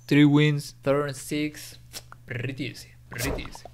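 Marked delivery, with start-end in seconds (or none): 2.3-3.6 whispered. Real, not acted. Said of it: "whispered aside".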